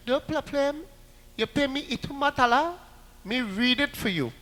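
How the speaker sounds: high, soft mock Chinese-Trinidadian accent; soft, sly haggling in mock Chinese-Trinidadian. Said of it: "they talk in a very funny Trinidad accent".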